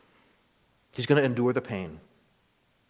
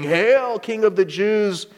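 mocking; 7 semitones higher, 3 dB louder, slower than usual. A narrator's reading. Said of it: "they mocked him".

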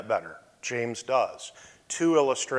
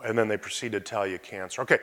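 ordinary talk

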